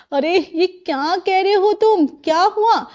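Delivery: slow, high-pitched "what?"; puzzled surprise. Acted. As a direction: react with bemused disbelief. Bride startled by groom's odd request.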